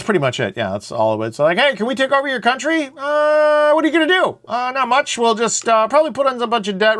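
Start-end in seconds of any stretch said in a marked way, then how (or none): 1.6-2.9 high-pitched put-on asking voice; hammed-up high query; mock negotiator. Acted takeover negotiation between countries. 2.9-4.4 hammed-up high questioning; mock hesitant reply. Other side answers in acted negotiation. 4.5-7.0 high, casual put-on voice; hammed-up offhand reply; mock negotiator. Acted answer in takeover skit.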